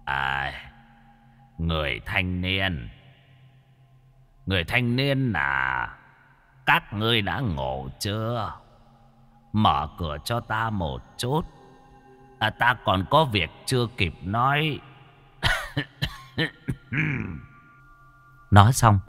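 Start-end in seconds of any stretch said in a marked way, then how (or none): 0.0-14.8 put-on old man voice. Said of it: "tiếng của lão nhân truyền đến". 16.4-17.4 put-on old man cough. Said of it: "âm thanh ho sù sụ".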